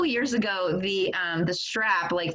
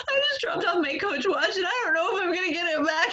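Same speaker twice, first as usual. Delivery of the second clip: tearful, voice higher and wavering; crying; 7 semitones higher than usual. Retold or imitated. Crying to her sister about the broken watch. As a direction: retell the call as if still crying. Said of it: "I started to cry".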